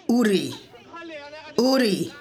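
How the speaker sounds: demanding watches in a half-hearted grunt; understated soldier's grunted demand. A narrator's reading. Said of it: "he grunts".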